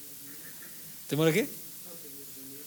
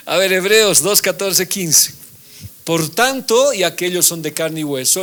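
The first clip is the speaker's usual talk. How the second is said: louder, raised proclaiming read; preaching; 5 semitones higher, 8 dB louder than usual. Acted. Preacher reading Hebrews to class, forceful proclamation. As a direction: announce the scripture with force.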